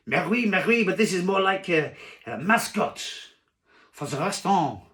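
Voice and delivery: loud, high French protest; French waiter accent. Acted. Waiter pleads for the mascot squid, loud French accent. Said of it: "I can't do a French accent".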